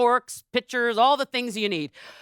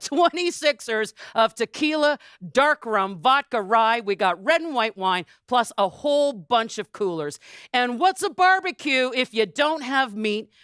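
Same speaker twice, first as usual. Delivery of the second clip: ordinary talk, nothing marked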